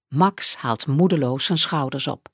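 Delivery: ordinary delivery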